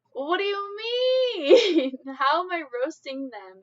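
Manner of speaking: high, loud, drawn-out objection; mock-indignant protest. Real, not acted. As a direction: stretch it into an incredulous whine. Protesting a chat accusation of roasting.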